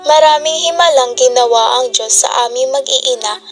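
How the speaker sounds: loud, fervent testimony to the congregation; church testimony. Acted. Leona tells the congregation God answered her, fervent testimony. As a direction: proclaim it like a grateful witness in church.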